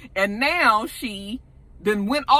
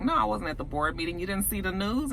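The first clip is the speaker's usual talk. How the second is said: low, soft, mildly dejected; retold dejection; 3 semitones lower, 7 dB quieter, faster than usual. Jacob telling her about the news, mildly dejected. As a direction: imitate a downcast man, quiet and low. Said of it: "looking all dejected".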